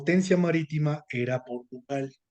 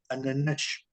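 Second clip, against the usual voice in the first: "ananás" with a final "sh" as in Portugal; European Portuguese accent; slower than usual. Shown as a demonstration. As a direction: end "ananás" on a hushing Portuguese sh. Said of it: "Porque en Portugal dirían". ("ananás" con acento de Portugal, final en "sh")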